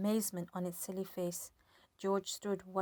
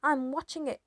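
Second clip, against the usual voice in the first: put-on old woman shouting; 7 semitones higher, 7 dB louder than usual. A narrator's reading. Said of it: "the old girl shouted back".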